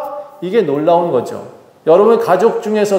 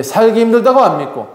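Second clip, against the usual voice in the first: usual speaking voice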